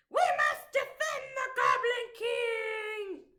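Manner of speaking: high, loud battle cry; rally the troops. Acted. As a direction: goblins vowing to protect their king. Goblins rally.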